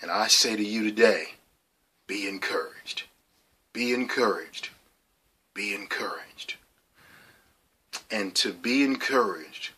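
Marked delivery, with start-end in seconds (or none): 2.1-3.0 soft unvoiced whisper; whispered blessing. Real, not acted. Speaking to viewers, passing on the blessing in a whisper. 5.5-6.6 soft unvoiced whisper; whispered blessing. Real, not acted. Speaking to viewers, passing on the blessing in a whisper.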